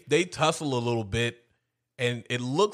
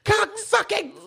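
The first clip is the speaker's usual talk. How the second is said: very high, fast hammed-up cursing tirade; high mock tirade. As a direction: spit out curses in a squeaky mock rage. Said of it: "She was like".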